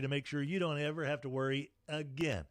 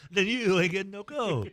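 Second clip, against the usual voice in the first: laughing; 7 semitones higher, 6 dB louder than usual. Real, not acted. Laughing joke at co-host.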